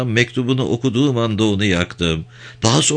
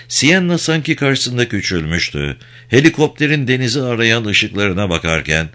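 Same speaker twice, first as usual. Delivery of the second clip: usual speaking voice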